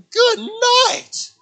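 very high, strained cry; pinned brother crying out in defeat; mock giving up. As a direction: squeal it like you're passing out. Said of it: "I'm like".

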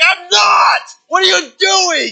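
high loud shouted protest; pinned down, shouting back at the cop; panicked protest. Retold or imitated. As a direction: yell back in alarm from the floor. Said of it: "I'm like".

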